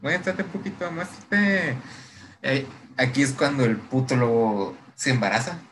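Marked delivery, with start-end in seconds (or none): 0.0-1.8 high, soft mock coaxing; playful coaxing. Agatha teasing Dracula to show himself, retold as playful high coaxing. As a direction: coax playfully in a raised, light voice.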